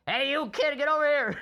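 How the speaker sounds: high, loud mock clown shout; hammed-up clown beckoning a kid; clown calling out. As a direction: yell it like a circus clown. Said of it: "one clown come in and says".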